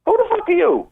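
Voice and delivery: high, loud mock-furious demand; mock angry challenge. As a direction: bark it like a guard catching an intruder. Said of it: "come back in and went".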